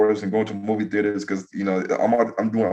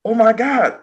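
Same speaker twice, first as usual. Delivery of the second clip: high-pitched imitation of a shocked woman; retold shock; 11 semitones higher, 4 dB louder, slower than usual. Retelling the sister seeing the map, shocked. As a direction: mimic her stunned exclamation. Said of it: "Yeah, she was shocked".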